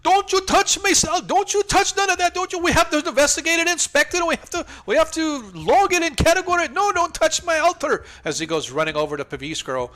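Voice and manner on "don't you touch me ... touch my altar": shrill-high panicked shout, much higher and louder; frantic high NPC. Acted. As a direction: screech at them to keep off the altar. Frantic NPC guarding his altar.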